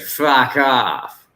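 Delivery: loud, dumb American twang; American accent. Shown as a demonstration. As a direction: yell it with a stupid American twang. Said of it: "that's American".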